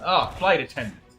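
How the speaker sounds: usual speaking voice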